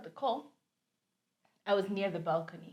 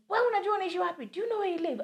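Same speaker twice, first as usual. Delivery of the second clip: high, sharp landlord scolding; angry landlord; 11 semitones higher, faster than usual. Retold or imitated. Landlord scolding tenant on the phone, angry high shout. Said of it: "she grabs the phone and she's like".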